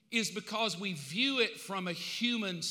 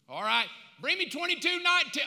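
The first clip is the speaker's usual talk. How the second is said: loud, higher shout across the room; calling out; 8 semitones higher, 6 dB louder than usual. Pretend. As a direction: shout for the next baby. God calling for a baby.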